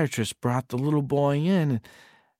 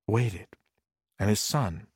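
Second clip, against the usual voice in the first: plain reading voice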